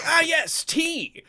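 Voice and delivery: high, loud delighted gush; mock Benjamin via barmaid. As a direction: gush about tea. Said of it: "gives a knowing look ... goes".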